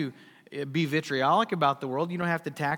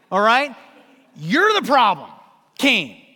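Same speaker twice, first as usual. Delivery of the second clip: loud accusation; 4 semitones higher, 9 dB louder than usual. Real, not acted. Preacher confronting Cain.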